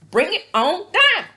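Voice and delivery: loud, high taunt with candy in hand; taunting shout. Retold or imitated. Vlogger retelling Lisa's dare at dinner, loud taunt. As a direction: belt out the dare, daring them to come on. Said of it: "pulls out a candy and says".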